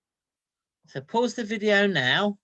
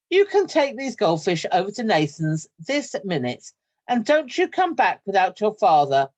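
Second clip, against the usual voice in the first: louder, firm scolding orders; scolding mother; 4 semitones higher, 8 dB louder than usual. Acted. Mother orders her son back to Nathan's, loud scolding. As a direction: send him off, no arguments.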